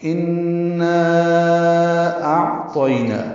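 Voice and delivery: انا اعطینا with the madd held long, four counts; madd stretched four counts. Shown as a demonstration. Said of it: "ہم اس کو چار حرکات لمبا کرتے ہیں". (انا اعطینا میں مد منفصل چار حرکات تک کھینچ کر)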